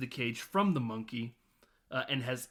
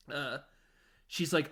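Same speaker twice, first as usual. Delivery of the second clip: ordinary talk, nothing marked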